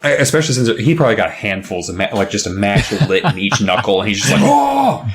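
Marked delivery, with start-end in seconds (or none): 4.4-5.1 sudden high yelp of pain; startled yelp. Retold or imitated. Boy burned by matches, a high startled yelp. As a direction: squeak it out like fingers got burned.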